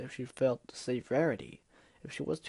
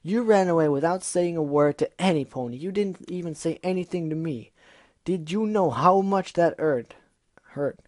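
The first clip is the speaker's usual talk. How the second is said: hurt shout; 4 semitones higher, 6 dB louder than usual. Acted. Gilda hurt and angry.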